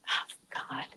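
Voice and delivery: breathy whispered oh God. Real, not acted. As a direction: whisper it to yourself. Muttering to herself.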